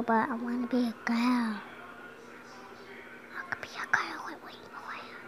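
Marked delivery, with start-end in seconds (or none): 3.5-5.2 soft unvoiced whisper. Real, not acted. Kid whispering a wish for rainbow hair. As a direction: whisper it to yourself.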